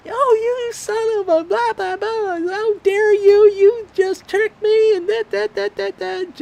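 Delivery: very high, loud, slow shrieking wife caricature; the wife caught in her prank, a loud falsetto caricature; mock outraged wife.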